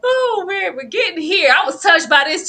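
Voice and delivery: normal talking voice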